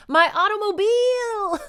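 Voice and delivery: very high, loud hammed-up wail, last syllable held; Cloris Leachman. Woman mourning her car in a frozen lake, exaggerated wail. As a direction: howl it theatrically, stretch the final vowel. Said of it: "Cloris Leachman".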